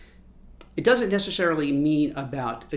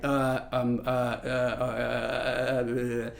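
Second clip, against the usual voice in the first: stumbling hesitation; 3 semitones lower, much slower than usual. Retold or imitated. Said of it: "they're like".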